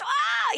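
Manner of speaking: high-pitched mock scream; imitated child's scream. Mother showing the constant noise she suffered, high mock scream. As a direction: shriek briefly like a screaming child. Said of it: "screaming all the time".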